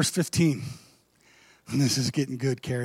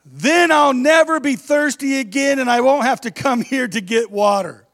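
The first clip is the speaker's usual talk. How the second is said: excited; 6 semitones higher, 5 dB louder than usual. Acted. Eager woman voiced in story.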